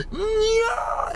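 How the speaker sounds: very high ecstatic squeal; the trees cry out in rapture. Acted. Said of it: "They're like".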